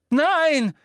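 greller hoher Schrei; schreiend. A narrator's reading. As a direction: schrei es entsetzt heraus. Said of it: "schrie Hermine". (laut und sehr hoch geschrien)

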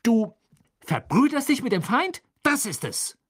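high, indignant outburst; outraged accusation. Acted. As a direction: hurl the accusation at a friend. Ron accuses Hermine of treachery.

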